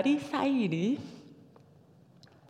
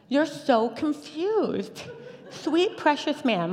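high, drawn-out, sugary condescension; exaggerated sweet pity, pitched high; mock pitying; 8 semitones higher, much slower than usual. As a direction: coo it slowly, as if to someone hopelessly lost. Retelling her reaction to his confusion.

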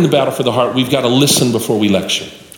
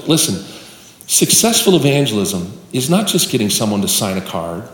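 normal talking voice

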